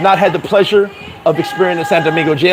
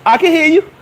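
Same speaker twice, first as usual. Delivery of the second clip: mocking reply; 10 semitones higher than usual. Taunting cops again.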